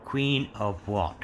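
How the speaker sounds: usual speaking voice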